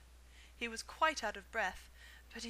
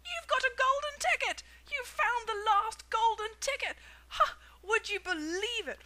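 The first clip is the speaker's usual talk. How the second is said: high, loud ecstatic shouting; excited screaming; 10 semitones higher, 10 dB louder than usual. A narrator's reading. Shopkeeper calling everybody to see the ticket, loud excited. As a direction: yell it to the whole street. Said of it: "screamed the shopkeeper".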